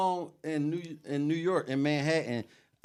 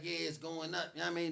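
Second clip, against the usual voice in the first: lightly mimicked young rapper hyping himself, softer; young boy voice; 11 dB quieter than usual. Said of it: "what's that voice?".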